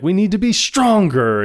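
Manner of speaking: high swell on the last word; rally the room like a mock motivational speaker; imitated rallying speech. Imitates a rousing conference speech.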